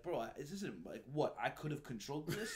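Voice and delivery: a freaked-out recruit who can't believe it; bugging out. Retold or imitated. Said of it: "they call us bugging out".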